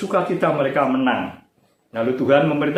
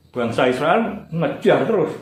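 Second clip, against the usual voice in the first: ordinary delivery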